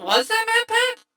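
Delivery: high, stunned disbelief; genuinely floored question; surprised. Real, not acted. Realizing the actor's identity.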